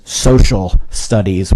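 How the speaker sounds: "social" stretched and leaned on loudly; stress on social. Real, not acted. Parent teasing their oldest about a major, stress on "social". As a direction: hit the word "social" hard before "studies". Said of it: "with an emphasis on social".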